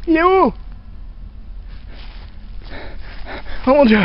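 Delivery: panicked high screaming; scared horror game streamer. Acted. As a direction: shriek for your lost friend in terror. Sees the ghost clown, lost.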